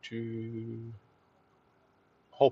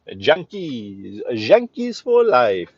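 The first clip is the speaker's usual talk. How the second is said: slow Bronx drawl on Yankees, the Y buzzing like a zh; a proud Bronx local's Yankees; Bronx accent; 4 dB quieter, much slower than usual. Shown as a demonstration. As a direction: lean into the Bronx accent, dragging out Yankees for life. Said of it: "That's how they say it in the Bronx".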